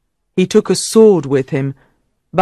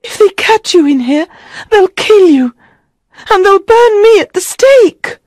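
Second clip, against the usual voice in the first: very high frantic woman's voice; terrified queen, high and loud; desperate; 5 dB louder than usual. A narrator's reading. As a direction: cry out in panic, pitched way up. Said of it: "Guinevere looked desperately".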